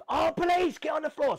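loud high barked order; retold police raid shout. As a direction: yell it like armed police bursting in.

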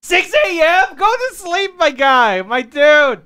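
loud, slow, exasperated nagging; loud scolding. Acted. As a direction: yell at him to go to sleep. Scolding a friend to sleep.

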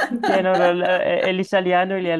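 words spoken through a laugh; laughing while talking. Real, not acted. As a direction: keep laughing as you start. Laughing at the language mix-up.

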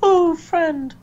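very high, loud mock cooing; mock falsetto. Co-host echoing a joke about Ronda's friend, high-pitched mocking. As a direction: squeal it high in playful mockery.

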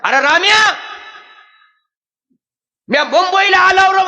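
loud, high village call down the phone; villager calling loud. Retold or imitated. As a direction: shout it like a farmer on a phone call. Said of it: "आपल्या शेतकरी माणसाचं बोलणं कसं असतंय".

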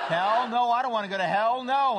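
high, loud, vehement refusal; protesting cat. Acted. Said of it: "here's how a cat gets to heaven".

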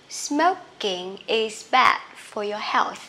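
careful, stretched-out teaching pace; slow model reading. Shown as a demonstration. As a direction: spell out the rhythm word by word. Slow then normal model sentence. (đọc mẫu chậm rãi từng cụm từ)

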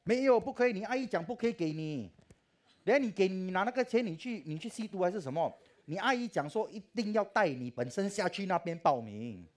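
high loud nagging mother; mother's insistence. Retold or imitated. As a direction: shout him down like a strict mum.

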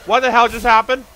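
loud, high disbelieving cry; real stunned exclamation; shocked outburst. Real, not acted. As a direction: react as if the floor vanished. Shocked by sudden game event.

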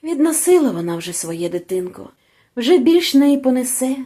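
usual speaking voice

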